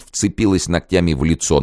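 ordinary delivery

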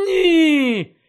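loud, high cry of horror; horrified shout. Acted. Harry seeing Dumbledore fall, shouting in horror. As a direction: yell it in shock.

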